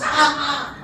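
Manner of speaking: loud high furious scream; outraged husband's scream; screaming chief. Acted. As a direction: let out a shocked angry yell. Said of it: "he was screaming at the top of his lungs".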